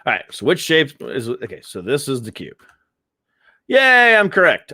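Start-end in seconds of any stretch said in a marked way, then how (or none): 3.7-4.7 higher, louder mock triumph; mock cheer. Vlogger gloating over the easy cube question, mock cheer. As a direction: cheer like you won a prize.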